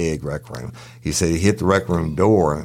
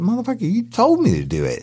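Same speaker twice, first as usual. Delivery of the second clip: higher-pitched, indignant comeback; indignant protest. Retold or imitated. Guard protests to the boss who gave the order, indignant. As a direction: throw it back as a heated retort.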